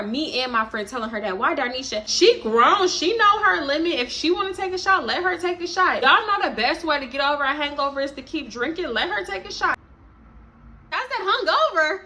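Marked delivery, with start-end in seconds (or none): 2.1-9.8 higher, pushy know-it-all tone; mock sassy defense. Darnisha lecturing the friends, in a high, sassy mock voice. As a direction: talk down to the group like they're overreacting.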